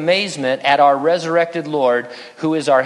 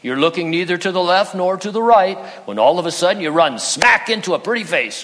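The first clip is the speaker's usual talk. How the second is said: storytelling owl rising into giddy excitement; 4 semitones higher than usual. Retold or imitated. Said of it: "Owl says".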